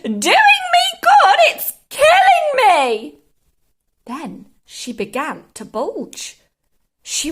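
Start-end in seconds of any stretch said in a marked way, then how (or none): 0.0-3.2 high, slow, very loud screech; outraged scream. A narrator's reading. Grandma blaming George's medicine, outraged screaming. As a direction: draw it out into a scream. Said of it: "she screamed".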